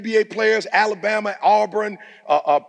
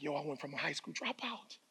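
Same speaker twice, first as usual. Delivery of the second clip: soft, awed, near whisper; hushed disbelief; 2 semitones lower, 15 dB quieter than usual. Retold or imitated. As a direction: hush it like a secret wonder. Retold inner amazement, hushed.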